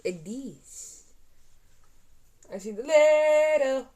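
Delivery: ordinary delivery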